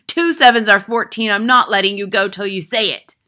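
furious full-volume headmistress; Trunchbull bellow. A narrator's reading. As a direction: bellow the sum at a pupil. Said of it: "Miss Trunchbull bellowed".

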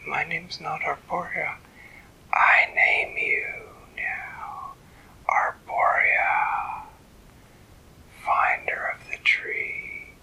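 slow ghostly whisper, drawing out the name; eerie tree whisper. A narrator's reading. Tree voice continues.

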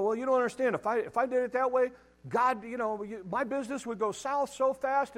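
mock protesting businessman. Preacher voicing a doubting businessman, a mock anxious protest. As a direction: play the worried businessman pushing back.

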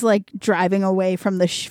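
ordinary talk, nothing marked

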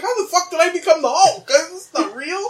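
high-pitched, incredulous outcry; retold disbelief. Man retelling his dream discovery of being the Hulk, incredulous. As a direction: voice shooting way up in mock shock.

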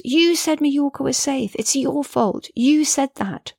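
high, yelled blame on YOU; yelling. A narrator's reading. Daughter yelling blame at her mum from the back seat. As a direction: yell it back at her mother. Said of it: "she yells".

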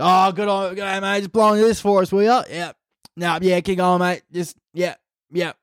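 casual roadside chat, acted out; chatty Australian cop. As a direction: imitate a friendly officer rambling along. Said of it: "some of them have a full-on conversation".

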